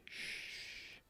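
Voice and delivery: soft unvoiced shush. Real, not acted. Hushing the toddler while recording.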